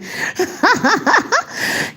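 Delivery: bright burst of real laughter, pitched high. Real, not acted. Reacting to chat comment.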